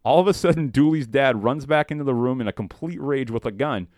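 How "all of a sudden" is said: ordinary talk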